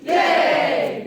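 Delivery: loud high shout yay; loud high cheer. Real, not acted. Cheering the class.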